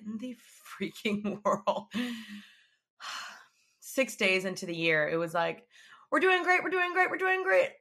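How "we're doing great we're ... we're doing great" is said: high, insistent chant of reassurance; mock optimism. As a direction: chirp it over and over, high-pitched. Mock optimism before things went wrong.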